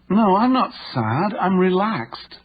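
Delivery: high, slow, words at odds with the tone; incongruent tone. Retold or imitated. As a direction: sound tense while claiming calm. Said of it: "a tone of voice that was incongruent with her words".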